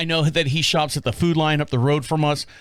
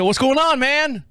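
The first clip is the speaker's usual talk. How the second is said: friendly call; 10 semitones higher, slower than usual. Retold or imitated. To the stuck old man, a friendly high call. Repeated greeting, louder.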